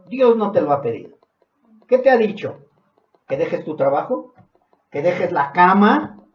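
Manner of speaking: loud, insistent rhetorical questions; forceful preaching. Real, not acted. Preacher confronting believers on what to give up, insistent. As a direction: drive each question home at pulpit volume.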